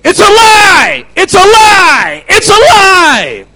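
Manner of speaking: very high, drawn-out full-force yell; shouted rebuke. Retold or imitated. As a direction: scream the accusation slowly over the singing. Retelling his rebuke during worship.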